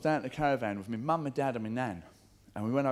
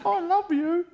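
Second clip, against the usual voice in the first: mock swoon; 6 dB louder, slower than usual. Mock lover in joke.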